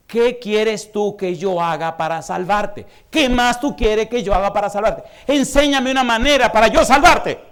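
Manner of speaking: slow, high, loud pleading as God; voicing God in a loud pleading sermon. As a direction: beg like God pleading to save. Preaching God's plea.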